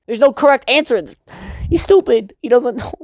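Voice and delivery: high-pitched, worked-up comic protest; exasperated rant. Real, not acted. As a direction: protest loudly, voice shooting up. Exasperated rant at clueless character.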